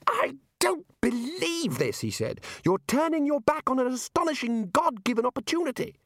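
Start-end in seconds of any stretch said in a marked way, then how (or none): 0.1-2.0 high, slow, anguished outburst; exploding. A narrator's reading. Young wizard exploding at his foolish colleagues, exasperated outburst. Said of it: "Ponder exploded". 2.6-6.0 high-pitched, exasperated tirade; exploding. A narrator's reading. Young wizard exploding at colleagues abandoning a chance, outraged. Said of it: "Ponder exploded".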